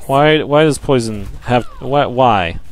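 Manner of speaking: ordinary talk, nothing marked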